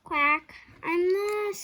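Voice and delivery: high, loud little cry; a lost duckling calling; small lost voice. A narrator's reading. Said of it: "said the voice".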